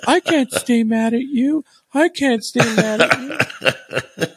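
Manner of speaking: high, slow, drawn-out softening; mock making-up. Co-hosts making up after argument, mock tender. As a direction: melt into a high, stretched-out coo.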